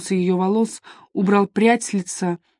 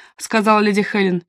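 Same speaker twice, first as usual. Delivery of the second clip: ordinary delivery, nothing marked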